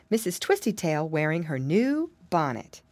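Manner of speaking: ordinary delivery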